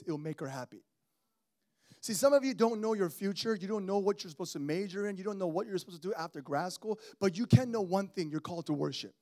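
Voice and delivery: normal talking voice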